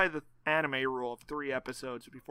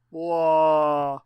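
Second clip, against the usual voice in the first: Dracula blah; 7 dB louder than usual. Pretend. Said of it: "My Eastern European accent".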